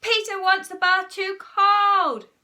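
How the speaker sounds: loud, high, drawn-out yell; outraged child shouting; shouted tale-telling. A narrator's reading. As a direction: yell your complaint to a parent. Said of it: "shouted Henry".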